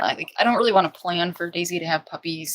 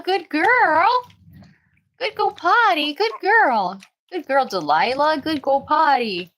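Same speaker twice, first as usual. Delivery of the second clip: squeaky sing-song praise for the puppies, much higher; high puppy praise voice; 8 semitones higher, 4 dB louder than usual. Real, not acted. Praising puppies after potty.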